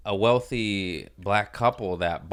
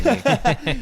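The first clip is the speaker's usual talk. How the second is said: laughing; 14 semitones higher, 5 dB louder than usual. Real, not acted. Joking about birthday posts.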